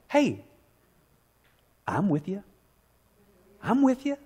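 Jesus gently answering a lonely believer; gentle interruption. Pretend.

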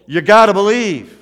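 big ringing climax of a sermon; rally cry. Real, not acted. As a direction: proclaim it to rouse the congregation. Preacher delivers church's rally cry.

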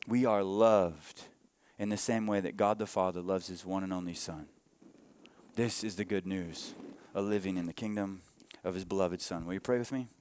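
low, soft, tender preaching; hushed sermon close. Real, not acted. Preacher inviting the church to pray, hushed sermon close. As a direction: bring the sermon down gently toward prayer.

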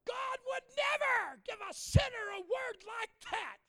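shrieking irate lady, high and loud; screaming. Retold or imitated. Lady screaming at the visiting preacher, high outraged shriek. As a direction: scream it in full-voiced outrage. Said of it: "she s-, starts screaming".

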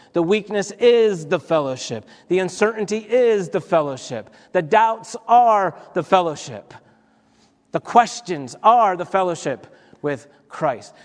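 rising, insistent preacher cadence, punching is; preaching refrain. Acted. Pastor drives home the point to the congregation, building preaching cadence.

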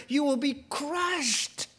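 lightly voiced Jesus, dread in it; stern Jesus warning. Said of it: "Jesus says".

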